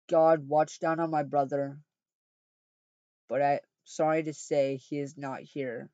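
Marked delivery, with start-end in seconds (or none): none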